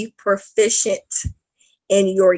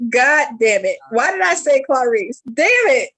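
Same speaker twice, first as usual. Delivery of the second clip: ordinary delivery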